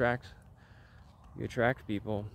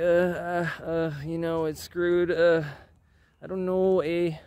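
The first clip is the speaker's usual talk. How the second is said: higher-pitched, doomy mock voice; terrible Paul Beckwith impression; 5 semitones higher, slower than usual. Vlogger mocks a climate video maker getting more doomy, bad impression. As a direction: badly mimic a gloomy doomer, pitched up. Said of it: "That was a terrible impression".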